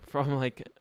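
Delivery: normal talking voice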